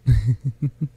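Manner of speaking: light, rippling giggle; short teasing laugh; amused chuckle. Acted. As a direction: laugh lightly at their reaction. Amused by the flirting.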